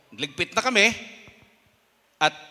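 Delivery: usual speaking voice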